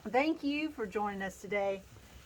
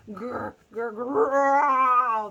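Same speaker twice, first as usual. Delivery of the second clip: stomach growling louder; 8 dB louder, much slower than usual. Acted. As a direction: growl building to a loud roar. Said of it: "The growling got louder and louder".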